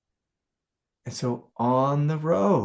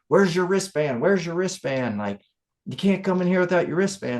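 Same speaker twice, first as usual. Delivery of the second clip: retold yelling; 6 semitones higher than usual. Lady at the campsite demanding his wristband, mock yell. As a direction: bark it at him like a gatekeeper. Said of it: "some lady starts yelling at me".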